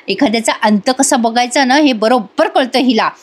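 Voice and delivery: plain reading voice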